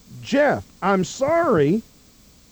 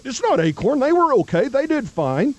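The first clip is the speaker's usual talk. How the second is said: higher-pitched quote of liberals; mock liberal protest; 6 semitones higher than usual. Host quoting liberals who defend Acorn, mock protest. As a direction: lightly mimic a liberal's excuse, pitched up. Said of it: "there's liberals out there that say".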